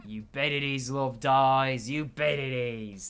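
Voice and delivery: loud, gruff, laughing bravado; rowdy scavenger. Acted. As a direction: brag like a rough dock hand. Voicing a rowdy NPC.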